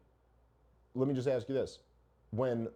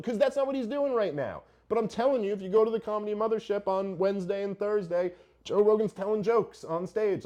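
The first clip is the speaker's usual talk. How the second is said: louder and higher, pressing the point hard; heated, raised voice; 7 semitones higher, 6 dB louder than usual. Real, not acted. Arguing with a critic on a podcast, heated and loud. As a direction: push the argument up, loud and high.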